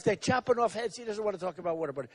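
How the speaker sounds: inner thought aside. Retold or imitated. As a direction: say it to yourself under the breath. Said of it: "I'm saying to myself".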